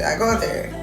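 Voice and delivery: usual speaking voice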